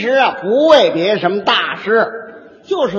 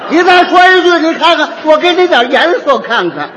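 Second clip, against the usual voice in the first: higher, louder mock threat; hammed-up bully squaring up; threatening the husband; 6 semitones higher, 5 dB louder than usual. Rowdy mediator threatens the husband, hammed-up angry. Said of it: "哪有这样劝的".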